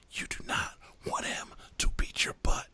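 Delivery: hushed whisper of warning. Retold or imitated. Leah warning her friends in the car, whispered. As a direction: whisper it like a secret to the others.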